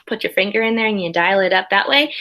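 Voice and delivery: ordinary talk, nothing marked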